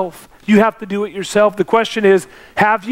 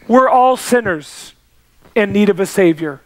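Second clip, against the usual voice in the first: usual speaking voice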